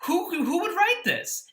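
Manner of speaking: incredulous. Real, not acted. Baffled by the letter.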